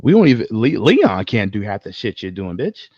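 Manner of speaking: ordinary talk, nothing marked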